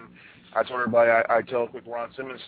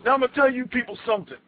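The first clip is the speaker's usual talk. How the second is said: higher, quick promo callout; wrestling promo; 11 semitones higher, 4 dB louder, faster than usual. Retold or imitated. Ron Simmons addresses the stadium crowd, imitated promo voice.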